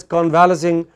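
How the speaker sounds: convalescing as con-VAL-uh-sing; mistaken pronunciation. Shown as a demonstration. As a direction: stress VAL, weaken the rest of convalescing. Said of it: "when you pronounce it as".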